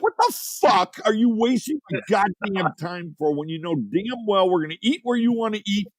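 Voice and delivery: mock exasperated rant at his wife; retold angry outburst. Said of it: "And I'm like".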